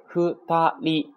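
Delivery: ふたり slow and high, in three separate beats; kana by kana. Shown as a demonstration. Teacher introduces the word, ふたり syllable by syllable. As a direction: sound out ふ, た, り one by one. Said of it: "读成ふたり啊".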